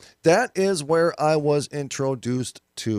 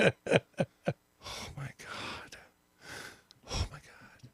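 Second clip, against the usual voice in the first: whispered disbelief; 12 dB quieter, much slower than usual. Real, not acted. Stunned reaction after laugh.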